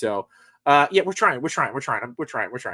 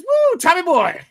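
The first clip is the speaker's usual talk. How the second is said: excited; 14 semitones higher, 7 dB louder, slower than usual. Retold or imitated. Reading chat comment with flair.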